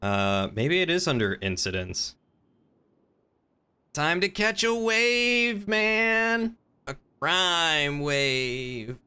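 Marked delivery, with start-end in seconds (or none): 3.9-9.0 high, slow laid-back surfer drawl; totally stoked beach bum voice; surfer dude. Acted. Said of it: "Surfer Cop".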